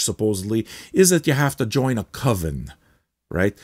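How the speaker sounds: ordinary talk, nothing marked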